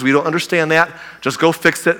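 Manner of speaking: ordinary talk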